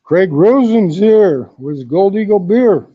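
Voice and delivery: loud, slow hype announcement. Real, not acted. Thanking viewer Craig on stream, loud slow announcer call.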